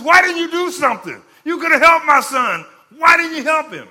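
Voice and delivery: loud, high-pitched angry accusation; acted-out outraged shouting at someone who failed; irate father. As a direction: yell it as a furious, grieving father. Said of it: "he is irate".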